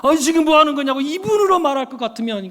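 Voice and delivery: high, loud alarmed objection; acted indignant outburst; startled protest. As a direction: protest in shock as the aide. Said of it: "부사관이 막 깜짝 놀라고".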